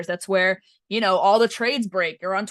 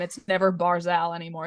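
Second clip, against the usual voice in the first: usual speaking voice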